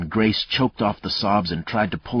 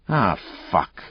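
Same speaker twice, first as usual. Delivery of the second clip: exasperated; 3 semitones higher than usual. Acted. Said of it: "Mayle said, exasperated".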